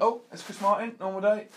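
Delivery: high, loud comic aside; Ricky Gervais. Retold or imitated. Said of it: "the Ricky Gervais".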